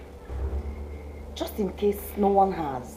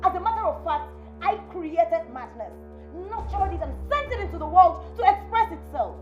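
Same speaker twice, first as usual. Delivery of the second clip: daughter-in-law snarling back in a fight; defiant rage; 9 semitones higher than usual. Acted. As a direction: throw madness back in her face. Sisters-in-law quarrel, defiant.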